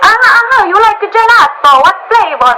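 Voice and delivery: loud, high, hammed-up Italian accent; bad Italian accent. Italian stranger trying to cheer up tourist, exuberant Italian accent. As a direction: cheer someone up in booming mock Italian. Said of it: "My Italian accent is so bad".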